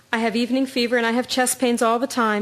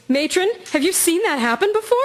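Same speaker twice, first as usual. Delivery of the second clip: higher, uneasy and urgent; alarmed question; 7 semitones higher than usual. Acted. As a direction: ask the matron in alarm. Alarmed nurse asks the matron.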